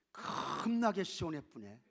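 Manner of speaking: slow Jeolla drawl on 겁나게; Jeolla dialect. Acted. Preacher shows how Jeolla people talk, Jeolla dialect. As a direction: imitate Jeolla speech, stretching the first syllable. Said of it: "전라도 사람들은 어떻게 말할까?".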